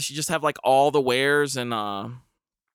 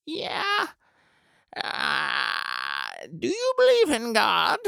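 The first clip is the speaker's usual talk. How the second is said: put-on very high old lady voice asking about God; elderly Southern neighbor; 8 semitones higher, much slower than usual. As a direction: pitch way up, prim and probing. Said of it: "she's just like".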